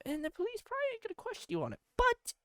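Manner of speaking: ordinary talk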